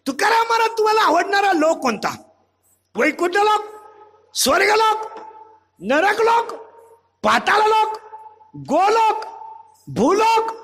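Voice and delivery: high-pitched, calling questions with rising endings; eager questioner. Acted. Devotee asking Tukaram which world he likes, high and insistent.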